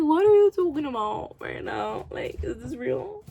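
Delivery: loud, high-pitched disbelief; incredulous outburst. Retold or imitated. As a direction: exclaim it in stunned disbelief. Reacting in disbelief to the plot.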